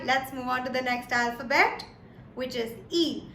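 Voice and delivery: ordinary delivery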